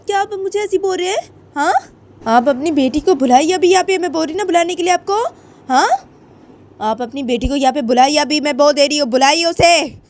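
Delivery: high, loud raging demands; angry mother yelling at the host; furious shouting. Acted. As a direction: scream at her to bring the daughter.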